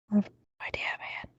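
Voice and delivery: breathy unvoiced whisper. Real, not acted. Co-host whispers his reply in disbelief. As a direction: whisper it, barely there.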